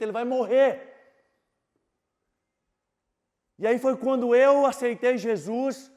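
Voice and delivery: loud, high preaching of his own pain; fervent testimony preaching; impassioned preaching. Acted. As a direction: cry it out to the church.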